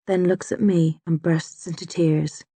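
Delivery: ordinary delivery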